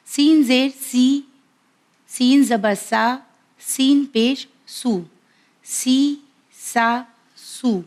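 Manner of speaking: سین زیر سی said slow and clear, vowel drawn out; teacher's letter drill. Shown as a demonstration. Teacher drills viewers on سین with its three vowel marks, slow and clear. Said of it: "ہمیں اس طرح سے اسے ادا کرنا ہے".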